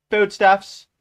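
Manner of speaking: high, loud heading called out; loud, high announcement; announcer's call. A narrator's reading. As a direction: proclaim it like a public bulletin. Announcer calls out heading of figures.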